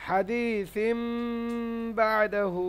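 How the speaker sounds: "হাদিসিম বাদাহু" slowly with a long hummed m; "হাদিসিম বাদাহু", n joined as a nasal m; iqlab recitation. Shown as a demonstration. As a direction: hold the m before "বাদাহু", stretch the long vowels in "হাদিসিম বাদাহু". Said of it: "বা যেমনই মেলাবেন".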